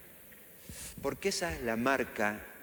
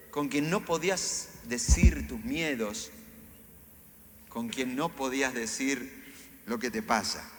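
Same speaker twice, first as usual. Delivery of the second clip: ordinary talk, nothing marked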